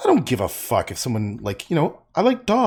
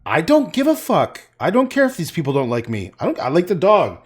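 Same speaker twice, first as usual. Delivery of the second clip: defiant retelling, raised voice; retold out loud; 5 semitones higher, 4 dB louder than usual. Declaring love of dogs to an embarrassed buddy, retold out loud. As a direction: re-enact a loud, unbothered declaration. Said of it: "literally said out loud".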